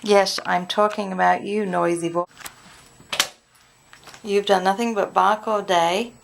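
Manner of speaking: loud telling-off to the dog; scolding dog. Real, not acted.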